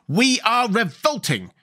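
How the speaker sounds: slow, high, fierce declaration. A narrator's reading. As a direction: declare it fiercely, drawing out each word. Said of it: "exclaimed the general fiercely".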